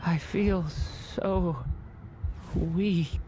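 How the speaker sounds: halting, drained delivery; faint from exhaustion, word by word; weakened. Acted. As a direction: drag out each word with failing strength. Weakening, says he feels weak.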